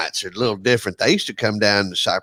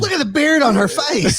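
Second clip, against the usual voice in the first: much higher, silly gawking voice; mock gawker. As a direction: gape at it like a sideshow. Co-host joins the mock tour.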